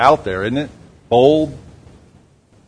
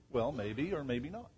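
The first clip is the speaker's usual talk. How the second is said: normal talking voice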